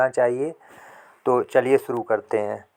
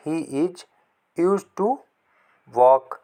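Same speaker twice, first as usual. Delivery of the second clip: emphatic model sentence; 4 semitones higher, much slower than usual. Shown as a demonstration. Lift the middle, hit walk hard. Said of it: "उसे टहलने की आदत है".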